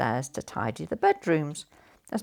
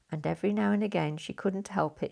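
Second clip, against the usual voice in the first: ordinary delivery, nothing marked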